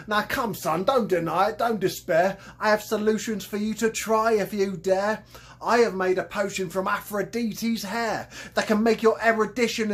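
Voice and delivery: a grand Cockney hustler selling potions; Cockney con man. Acted. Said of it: "His thick Cockney accent".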